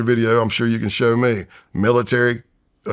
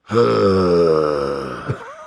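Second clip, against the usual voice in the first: heavy sigh; 4 semitones lower than usual. Retold or imitated. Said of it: "you can hear it in the recording".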